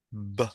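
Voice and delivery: "B" as a short, soft "buh"; one isolated "buh" for "B"; letter sound of B. Shown as a demonstration. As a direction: release the lips on "B" as a sound, not its name. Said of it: "la misma articulación".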